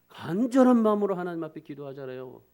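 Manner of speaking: usual speaking voice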